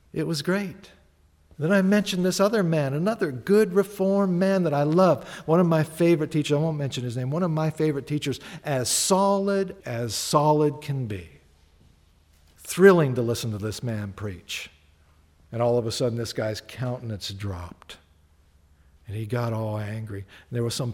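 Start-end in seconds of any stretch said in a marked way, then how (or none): none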